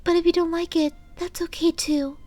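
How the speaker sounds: high, timid, hesitant pony; high bashful voice, fearing rejection; nervous and shy. Acted. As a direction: ramble nervously, hoping he likes it. Fluttershy nervously explains gift.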